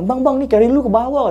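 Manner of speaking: very high-pitched imitated alert; urgent calling out. Friend alerts narrator his pack is gone, urgently. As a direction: call out urgently, pitched right up.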